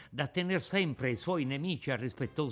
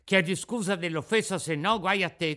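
put-on higher, louder outraged boy voice; mild mock outrage of a gang; indignant schoolboys; 6 semitones higher, 6 dB louder than usual. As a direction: demand an apology, fuming. Boys outraged at the insult.

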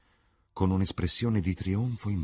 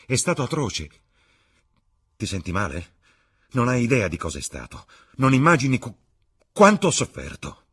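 building from cold to loud, higher; rising anguish; 7 semitones higher, 8 dB louder than usual. Acted. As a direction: grievance swelling into outcry. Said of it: "rispose lui". (Dorian sfoga la sua sofferenza, sempre più forte)

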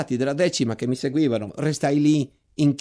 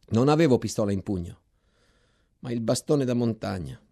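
ordinary delivery

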